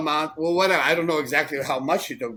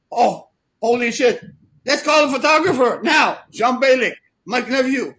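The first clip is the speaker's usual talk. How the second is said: high, loud, hammed-up panic; mock his frantic rush, high and loud; imitating panicked excitement; 10 semitones higher, 6 dB louder than usual. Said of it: "He started, like, panicking".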